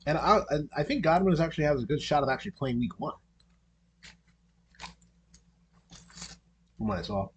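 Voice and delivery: talking around an ice pop; words slurred by a cold ice pop; eating an ice pop. Real, not acted. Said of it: "trying to enjoy an ice pop here".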